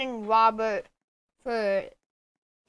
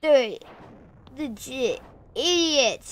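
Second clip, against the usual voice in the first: ordinary talk, nothing marked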